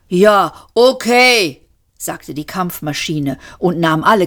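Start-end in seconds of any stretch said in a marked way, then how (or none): 0.1-1.6 louder, higher and drawn out; slow, forceful assent; machine bracing itself. A narrator's reading. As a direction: brace for it, stretching each word.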